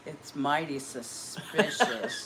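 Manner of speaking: slow, low, drawn-out mock suspicion; mock suspicious. Shown as a demonstration. Demonstrating her mad catchphrase, mock suspicious. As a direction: narrow it down like a skeptic. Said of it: "when I'm mad, I'll say".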